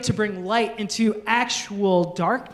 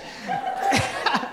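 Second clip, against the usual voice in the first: breathy laugh. Real, not acted. Laughs after joke.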